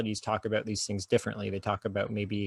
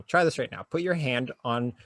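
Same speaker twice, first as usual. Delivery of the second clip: guided meditation. Shown as a demonstration. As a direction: talk them gently into noticing their breath. Leads a short guided exercise.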